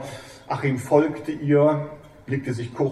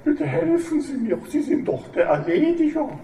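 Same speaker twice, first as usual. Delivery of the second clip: high, tearful and pleading; 14 semitones higher than usual. Acted. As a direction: confess it through tears, begging. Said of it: "Frau Kamps weinte".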